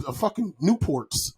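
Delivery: ordinary talk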